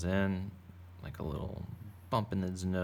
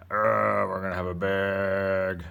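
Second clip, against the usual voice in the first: loud, drawn-out growling shark voice; angry shark growl; 8 dB louder, slower than usual. Acted. Artist drawing a big shark mouth, playful angry growl. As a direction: growl the words out slowly like a cartoon shark. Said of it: "So if you wanna get angry".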